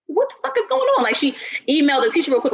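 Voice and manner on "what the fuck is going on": high, shocked what-the; startled disbelief; shocked outburst. Retold or imitated. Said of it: "So she like".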